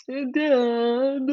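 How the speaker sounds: drawn-out whiny Dad. A narrator's reading. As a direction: whine it like a spoilt child. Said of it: "So whiny".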